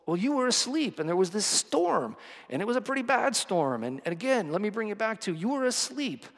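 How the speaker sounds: high, defensive, flustered disciples; whiny excuse. Pretend. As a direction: babble excuses in a high, squeaky whine. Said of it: "And they're like".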